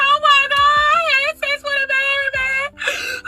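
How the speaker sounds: high-pitched mock sobbing; imitated crying. Telling best friend about the married man, in hammed-up crying. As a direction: wail it tearfully, drawing out the end. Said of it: "I'm crying so bad".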